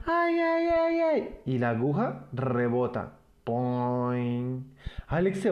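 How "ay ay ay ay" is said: put-on child's cry of pain. Alex yelping as the needle hits his bottom, high yelps. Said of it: "Alex grita".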